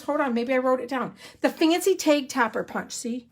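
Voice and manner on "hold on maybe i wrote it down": usual speaking voice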